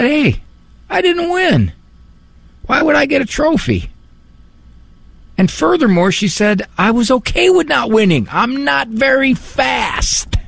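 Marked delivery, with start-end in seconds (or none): 0.0-3.9 put-on high, light little girl voice. 6.8-10.4 put-on high, light little girl voice. Little girl quoted again.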